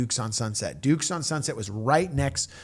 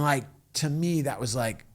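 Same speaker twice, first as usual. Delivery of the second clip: normal talking voice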